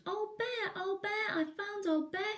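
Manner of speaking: high, excited little bear cry; Little Bear jumping up and down, calling out happily; excited shout. Acted. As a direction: shout for joy at finding a friend. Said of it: "he shouted".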